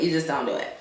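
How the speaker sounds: dropped almost to a murmur; fading under breath. Shown as a demonstration. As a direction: let it trail away quietly. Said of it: "repeated emphasis".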